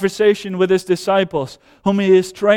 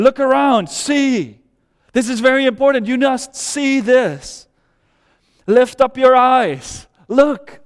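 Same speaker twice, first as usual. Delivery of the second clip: preaching at full force; 3 semitones higher than usual. Real, not acted. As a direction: hammer home the call to lift your eyes. Preaching Jesus' call to look.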